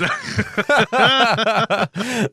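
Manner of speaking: loud, high burst of laughter; real, helpless laugh at a joke. Real, not acted. Cracking up at the dinosaur joke.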